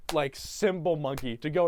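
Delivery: ordinary talk, nothing marked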